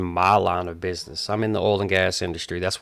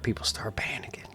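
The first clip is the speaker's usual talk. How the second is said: whisper; 8 dB quieter than usual. Real, not acted. Hushed while describing panic.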